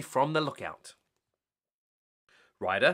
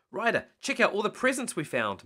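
higher, eager call; excitedly; 7 semitones higher than usual. Acted. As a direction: show off the find with excitement. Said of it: "Marshall said excitedly".

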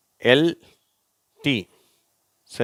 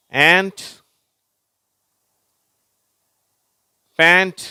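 loud, high-pitched, one word at a time; model word reading; 7 semitones higher, 6 dB louder, slower than usual. Shown as a demonstration. Teacher reading words for pupils to repeat, loud model reading. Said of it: "நான் வாசிக்கிறேன், நீங்களும் திருப்பி சொல்லுங்க".

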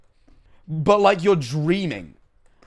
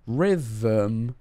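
slow dictation; 6 semitones lower, 5 dB quieter, much slower than usual. Real, not acted. Spells out web address while typing.

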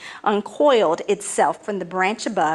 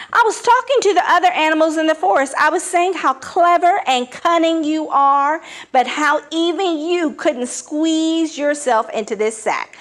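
eager flattering; 7 semitones higher, 3 dB louder than usual. Acted. Anansi flatters leopard.

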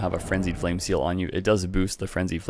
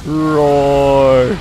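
very loud, higher roar; gleeful roar; 7 semitones higher, 10 dB louder than usual. Real, not acted. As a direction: bellow it triumphantly. Shouting spell name.

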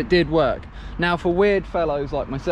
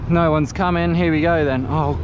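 ordinary talk

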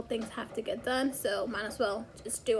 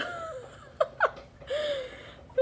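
helpless laughter, much higher. Real, not acted. Mom laughing helplessly at her daughter's note, high and teary. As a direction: laugh high until it nearly turns to crying.

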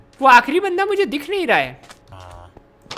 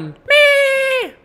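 squeaky reply; 14 semitones higher, much slower than usual. Acted. Answering own question in voice.

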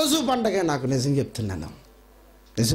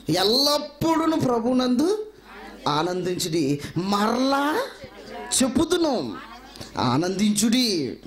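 proclaiming scripture; 5 semitones higher, slower than usual. Acted. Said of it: "నాలుగో అధ్యాయము నాలుగో వచనం చూడండి".

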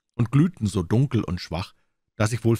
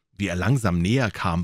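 ordinary delivery, nothing marked

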